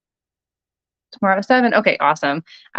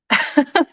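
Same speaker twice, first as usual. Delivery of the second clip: short laugh; 9 semitones higher than usual. Real, not acted. Laughs mid-sentence at own slip.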